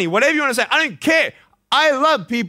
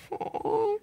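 soft, high-pitched melting "oh"; touched "oh"; 9 dB quieter than usual. Retold or imitated. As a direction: let out a small, high, moved "oh". His touched reaction to the compliment.

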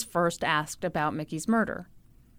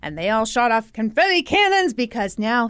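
ordinary delivery

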